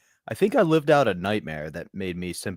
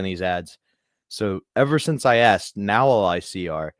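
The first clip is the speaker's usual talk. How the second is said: ordinary talk, nothing marked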